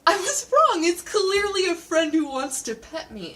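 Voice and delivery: giddy dog voice; dog's inner voice. Retold or imitated. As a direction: voice the dog's change of heart. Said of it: "the dog goes".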